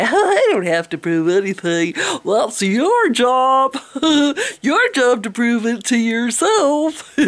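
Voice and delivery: slow, drawn-out mock retort with scoffing laughs; mocking imitation. Vlogger parodies believers dodging a demand for proof, mocking. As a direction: parody them with exaggerated, stretched words. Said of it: "then you turn around and say".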